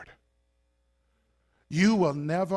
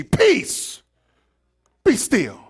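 commanding; 6 semitones higher, 8 dB louder, much slower than usual. Retold or imitated. Jesus commanding the sea.

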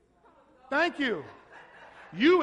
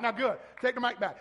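ordinary talk

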